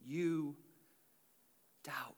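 soft, low, slowing question; gentle quoted question. Retold or imitated. Preacher quoting Jesus to doubting Peter, gently. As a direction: ask it quietly, letting it fade word by word.

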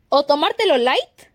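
usual speaking voice

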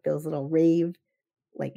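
normal talking voice

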